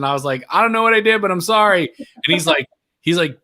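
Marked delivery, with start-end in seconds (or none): 0.5-1.9 high, loud retold apology; mock-panicked pleading. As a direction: blurt it out like you're in trouble. Retells panicked apology to manager.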